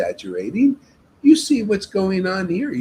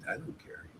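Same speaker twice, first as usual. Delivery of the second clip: whisper; 20 dB quieter than usual. Real, not acted. Humble aside after toilets offer.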